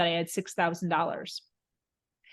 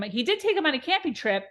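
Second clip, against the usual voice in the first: ordinary talk, nothing marked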